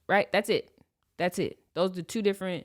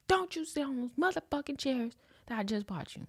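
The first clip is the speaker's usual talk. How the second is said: scolding mom; 8 semitones higher, 5 dB quieter than usual. Retold or imitated. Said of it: "gets to yelling and stuff".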